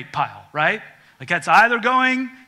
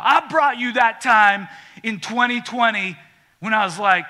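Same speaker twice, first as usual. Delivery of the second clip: louder, higher, indignant complaint; aggrieved Cain; 5 semitones higher, 6 dB louder than usual. Acted. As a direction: whine your grievance to God.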